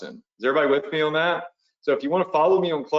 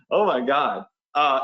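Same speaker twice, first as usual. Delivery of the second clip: normal talking voice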